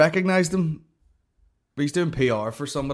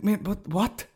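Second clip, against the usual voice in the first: high, halting confusion; mock bewilderment, pitched right up; baffled; 10 semitones higher, slower than usual. Comic answers an overkeen stranger, baffled.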